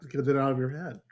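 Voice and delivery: ordinary delivery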